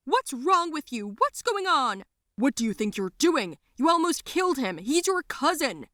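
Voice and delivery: high-pitched parental scolding; angry yelling. A narrator's reading. Parents yelling at girl who choked cousin, angry. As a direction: yell in shocked outrage, pitched high. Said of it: "they yelled at me".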